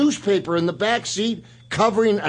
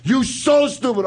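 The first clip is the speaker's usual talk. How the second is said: higher, mock broken-English rant at Nikolai; Sheik insulting Nikolai; 7 semitones higher than usual. The Sheik scolding his tag partner, in a lightly voiced accent. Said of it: "the Sheik would come back".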